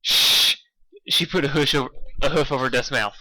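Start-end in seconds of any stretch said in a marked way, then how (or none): 0.0-0.6 sharp loud shush. A narrator's reading. Rainbow hushing Dusk, sharp shush. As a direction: hush them fast.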